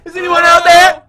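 high, loud calls into the echoing dark; playful cave hollering, testing the echo; calling into a cave. Pretend. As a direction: shout out to hear the echo come back. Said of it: "I always wanted to be in a cave and do that".

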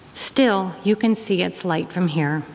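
usual speaking voice

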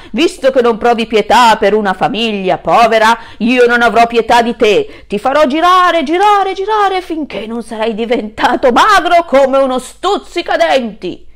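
very loud menacing voice; threatening wolf. Acted. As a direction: swing him round and threaten.

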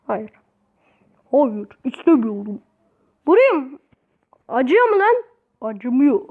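ordinary delivery

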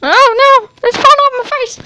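high, loud panicked cry; real surprise, shrieking; alarmed shriek. Real, not acted. Microphone falling off her face.

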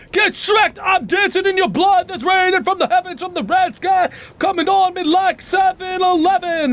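high-pitched shouted celebration; gloating victory rant. Real, not acted. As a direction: crow over the fallen boss at high pitch. Gloating over dead boss.

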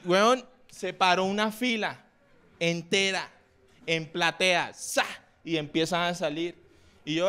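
ordinary delivery

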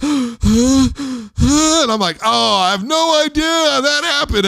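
high, loud, panicked gasp and squeal. Retold or imitated. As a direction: gasp in mock horror, voice shooting up.

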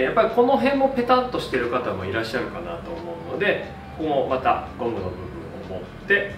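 usual speaking voice

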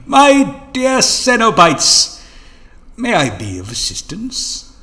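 slow, high, loud grand greeting; fawning greeting. Acted. Master courts the Cenobites, fawning grand greeting. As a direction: sweep in with exaggerated charm.

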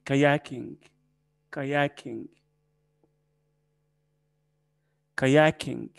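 "كاياكينج" with a long middle "yaa"; ka-YAA-king, teacher's model of "كاياكينج"; lengthened vowel. Shown as a demonstration. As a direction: stretch the second syllable of "كاياكينج". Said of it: "حتى فيها تطويلة شوية".

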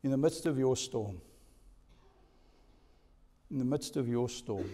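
hushed pulpit voice; soft sermon opening. Acted. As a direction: draw them in gently.

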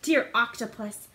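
ordinary delivery

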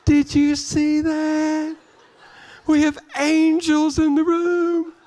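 high, slow, sing-song wonder; amazed exclamation. Retold or imitated. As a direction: marvel out loud, drawn out and high. Retelling amazed reaction.

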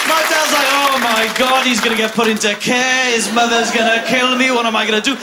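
normal talking voice

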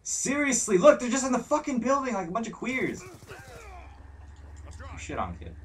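high, drawn-out taunt; jeering gamer taunt; mocking jeer. Real, not acted. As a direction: sneer at them like a schoolyard bully. Mocking the enemy players.

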